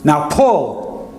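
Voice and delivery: high shouted command; shouting. A narrator's reading. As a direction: bark the order to pull. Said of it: "Uncle Chick shouted".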